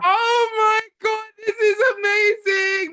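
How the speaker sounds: stunned gushing at the cover; overjoyed. Real, not acted. Guest sees her own magazine cover gift, overjoyed gushing.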